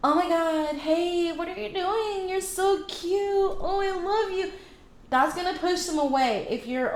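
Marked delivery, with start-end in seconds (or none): 0.0-4.5 high, slow, gushing mock sweetness; mock over-the-top girly gush; overly gushing girl.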